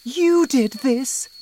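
slow, high, loud accusation; high-pitched, furious wife; Missus Cranky, accusing. A narrator's reading. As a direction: blame the husband shrilly. Narrator voices Missus Cranky.

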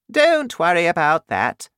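high, regal voice of the Queen; Queen's voice. Acted. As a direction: speak up high like a worried queen.

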